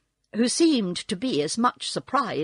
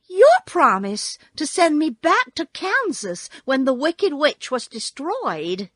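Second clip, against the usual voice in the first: put-on higher, louder girl; girl's voice; 6 semitones higher, 5 dB louder than usual. A narrator's reading. Girl reminding Oz of his promise, high insistent voice. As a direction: press the claim in a high girl's voice. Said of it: "said the girl".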